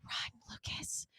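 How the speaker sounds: slow mock-urgent whisper; whispered warning. She silently warns Lucas about the woman, whispered warning.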